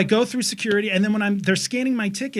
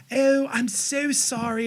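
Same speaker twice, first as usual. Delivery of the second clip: mock attendant; 7 semitones higher, slower than usual. Mimicking attendant.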